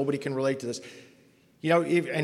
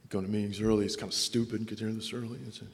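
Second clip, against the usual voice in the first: baiting mutter; 5 semitones lower, 9 dB quieter than usual. Retold or imitated. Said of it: "I've gotta bait you. I've gotta poke you".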